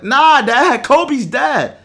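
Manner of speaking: loud, high, emphatic correction; retold insistence. Son telling dad the news is true, loud insistence. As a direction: push it through your dad's disbelief.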